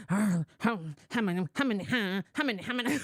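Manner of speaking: put-on low, far-off mumbling old man, hesitant; grumbling old poll voter. Poll worker mimics a confused, grumbling voter. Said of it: "It was in there grunting".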